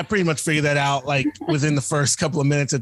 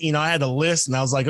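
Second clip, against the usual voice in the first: ordinary delivery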